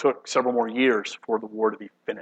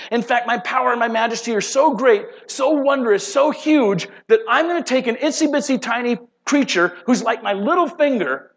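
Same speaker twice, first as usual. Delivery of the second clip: taunting God's challenge; 8 semitones higher than usual. Acted. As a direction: thunder it out as a gloating God. God taunting Lucifer in sermon.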